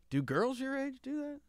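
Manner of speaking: soft, high, questioning dad; retold dad's hushed bewilderment; puzzled dad. Dad quietly questions his son.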